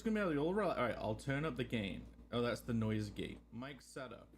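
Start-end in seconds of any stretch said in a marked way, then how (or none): none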